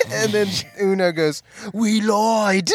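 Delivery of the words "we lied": mocking taunt. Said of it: "He, like, mocks him".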